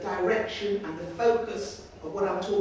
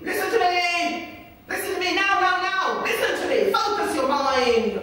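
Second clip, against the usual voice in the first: commanding shout; 10 semitones higher, 9 dB louder than usual. Retold or imitated. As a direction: seize his attention with a fierce yell. Confronting the gang leader.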